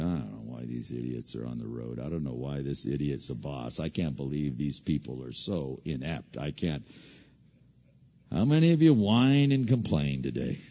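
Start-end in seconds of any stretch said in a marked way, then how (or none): 0.0-6.8 low, soft muttered grumble under the breath; inner grumbling. Retold or imitated. Congregant grumbling inwardly about idiots, low muttered complaint. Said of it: "in your mind go".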